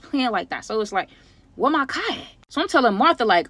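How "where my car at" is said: high-pitched, incredulous question; baffled outcry. Acted. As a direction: pitch leaps in mock disbelief. Discovers the car is missing.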